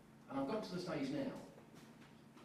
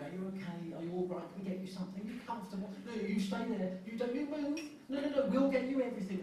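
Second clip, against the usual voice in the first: fussing friends; 6 semitones higher than usual. Retold or imitated. Friends fussing over broken leg.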